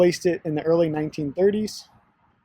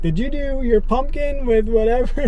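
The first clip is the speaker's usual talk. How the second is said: higher-pitched imitation of someone calling out an order check; mock yell; 8 semitones higher, 4 dB louder than usual. Coffee worker checking a customer's order, retold mock yell. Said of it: "like, yelled at him".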